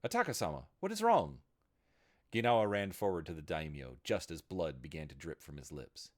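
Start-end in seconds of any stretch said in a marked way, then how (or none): 0.0-1.4 high, rising panic; panicked cry. A narrator's reading. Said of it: "her voice hinting at her panic".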